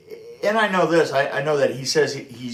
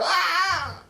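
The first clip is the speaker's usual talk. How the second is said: cry out. Real, not acted. Reacting to the story.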